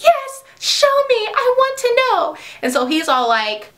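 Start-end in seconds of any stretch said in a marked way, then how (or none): 0.0-2.4 high, slow eager plea; mock eager girl. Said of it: "And she's like".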